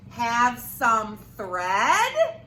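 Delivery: very slow, stretched monster request; slow drawled request. Acted. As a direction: stretch every word out. Hungry thing drawls request.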